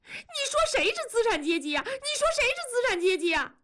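high, fast, tearful protest; near tears. A narrator's reading. Wife stung by husband's bourgeois jibe, near-tearful high protest. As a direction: repeat it high, on the verge of crying. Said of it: "文丽要哭了".

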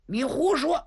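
high startled protest; indignant disbelief. Acted. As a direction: snap back in disbelief. Zhang Fang denies it's fake.

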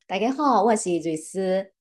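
greeting spoken in Teochew; Teochew dialect. Shown as a demonstration. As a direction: say 大家好 in Teochew with front-of-mouth vowels. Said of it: "能不能用方言，哎，跟大家打个招呼".

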